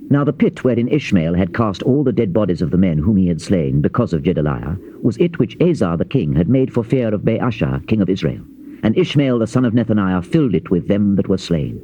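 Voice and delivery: ordinary talk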